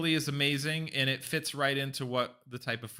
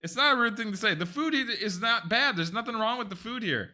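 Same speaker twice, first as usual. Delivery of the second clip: defensive protest; 7 semitones higher than usual. Real, not acted. Defending his words to Jules, affronted and higher. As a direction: pitch up high, defending himself.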